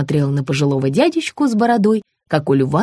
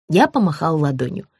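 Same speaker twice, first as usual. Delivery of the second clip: usual speaking voice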